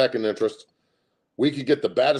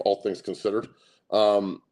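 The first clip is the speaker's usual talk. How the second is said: normal talking voice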